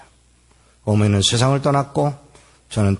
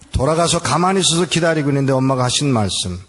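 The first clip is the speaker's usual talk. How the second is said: normal talking voice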